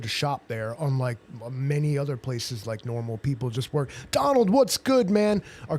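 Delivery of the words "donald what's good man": higher, louder hype shout-out; excited greeting. Real, not acted. Streamer greeting viewer Donald from the chat, loud and excited. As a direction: call out to Donald with real energy.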